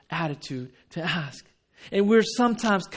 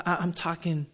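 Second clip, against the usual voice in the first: ordinary talk, nothing marked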